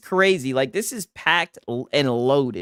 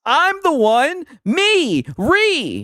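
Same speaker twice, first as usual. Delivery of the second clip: high, loud, drawn-out mock whine; mocking mimicry; 9 semitones higher, 4 dB louder, much slower than usual. Streamer mocking the man in the clip, high exaggerated mimicry. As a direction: screech it as a hammed-up taunt.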